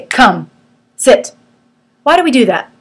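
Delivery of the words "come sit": louder, firm dog command; short, commanding come and sit; command with authority. Shown as a demonstration. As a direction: give the dog a firm order. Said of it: "It's authority".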